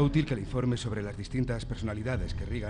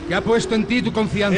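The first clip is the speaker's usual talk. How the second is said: shouted prayer; 9 semitones higher, 10 dB louder than usual. Acted. Young priest shouting responses in the exorcism. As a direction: shout the prayer over the demon.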